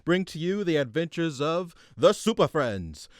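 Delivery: booming cartoon announcer, building hype. Announcer hyping the Super Friends to the audience, booming. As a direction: sell the heroes like a Saturday morning promo.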